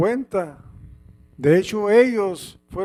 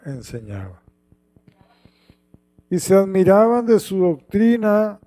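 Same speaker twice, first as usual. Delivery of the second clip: scripture read aloud, measured and solemn; lectura bíblica solemne; 5 dB louder than usual. Acted. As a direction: proclaim the gospel passage. (lectura solemne del evangelio)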